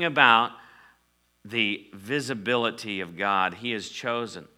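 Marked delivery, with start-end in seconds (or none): none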